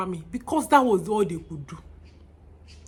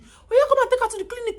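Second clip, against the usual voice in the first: urgent; 6 dB louder, faster than usual. Retold or imitated. Woman urgently sends for help.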